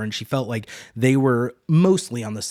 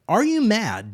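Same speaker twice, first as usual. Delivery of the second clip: much higher, louder disbelief; incredulous challenge; 10 semitones higher, 4 dB louder, slower than usual. Retold or imitated. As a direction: shoot it back in disbelief. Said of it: "Egwene is just questioning".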